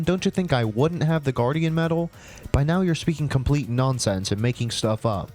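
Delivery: ordinary delivery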